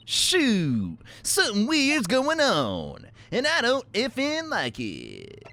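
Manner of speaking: high, drawn-out whine; exaggerated whine. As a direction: stretch it out in a high complaint. Whining reaction to strange events.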